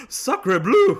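loud mock French exclamation; French chef. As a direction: cry it out like a shocked French chef. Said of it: "my internal monologue was just like".

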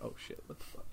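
very soft whispered swearing; hushed, creeped-out reaction; spooked whisper. Retold or imitated. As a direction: whisper it, mock-frightened in bed. Retold spooked reaction at bedtime.

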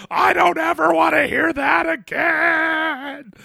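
high, slow, shouted outburst; furious dad yell. Retold or imitated. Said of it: "he flipped out".

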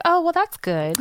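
high, sing-song fake approval; high mock-cheerful "that's good"; mock polite. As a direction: chirp it like a polite lie. Mock-polite reply in retold chat.

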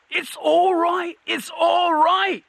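high, panicky troll cry; shouted. A narrator's reading. Troll backing away as Cohen advances, high shout. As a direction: call out high and drawn out to hold him off. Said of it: "it shouted as Cohen advanced".

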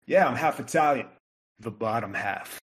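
quoting a friend. Retold or imitated. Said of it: "his line was always".

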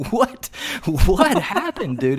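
a high, shocked What, then asking what happened; high, startled question to a friend; alarmed what. Retold or imitated. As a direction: jump up in pitch in alarm. Sees brown juice on his mouth.

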